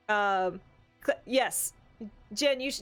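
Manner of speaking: normal talking voice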